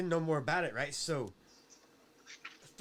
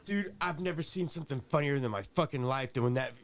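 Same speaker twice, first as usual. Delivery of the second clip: ordinary delivery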